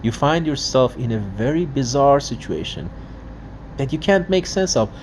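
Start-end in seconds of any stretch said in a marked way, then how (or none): none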